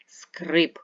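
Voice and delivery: "скрип" slow and loud on the и; stressed check vowel. Shown as a demonstration. Teacher making pupils hear the vowel, emphatic slow и in скрип. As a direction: punch and stretch the и in скрип. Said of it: "зробити наголошене".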